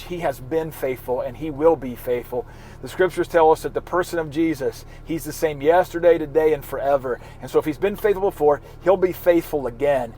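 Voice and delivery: ordinary talk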